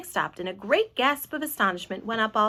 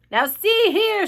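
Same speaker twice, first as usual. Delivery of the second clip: high, loud demand for attention; police chief calling up loudly; official shout; 10 semitones higher, 8 dB louder, slower than usual. A narrator's reading. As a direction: shout up sternly through cupped hands. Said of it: "shouted the chief of police".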